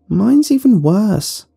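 put-on high, slow girl calling out; girl crying out. A narrator's reading. Girl calling classmates to her desk, high and slow. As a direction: draw it out, alarmed schoolgirl. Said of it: "one girl cried out".

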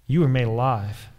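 normal talking voice

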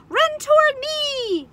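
high, drawn-out yell; long, loud high call; gander yelling. A narrator's reading. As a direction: holler like an excited gander. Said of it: "yelled the gander".

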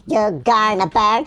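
ordinary talk, nothing marked

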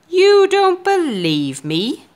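slow, high, loud giant voice; a giant scoffing, slow and loud. Acted. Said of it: "the giant said".

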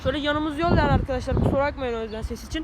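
speaking up over passing buses; loud to beat traffic noise. Real, not acted. Talking over road traffic noise. (trafik gürültüsünü bastırarak yüksek sesle)

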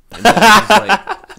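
loud narration breaking into laughter; can't keep a straight face, loud and high; laughing through it. Real, not acted. Narrator cracking up mid recap, laughing.

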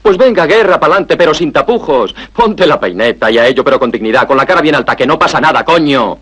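voz alta y aguda, de un tirón; lánzalo a la batalla con ímpetu; arenga exaltada. Acted. Arenga a Nacho a lanzarse. (arenga enardecida, con el tono arriba)

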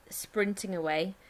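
ordinary talk, nothing marked

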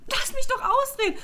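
high-pitched frustrated protest; exasperated plea. Pretend. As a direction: beg to finish, fed up. Imagined plea to parents.

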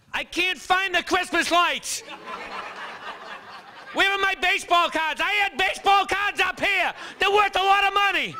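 high, loud hunting-through-boxes yell; mother shouting from attic. Retold or imitated. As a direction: yell it up from the attic like a frantic son.

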